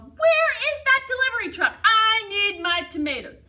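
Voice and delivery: very loud, high shout; yelled. Acted. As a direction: yell it down the street. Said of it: "stomped out onto the sidewalk and yelled".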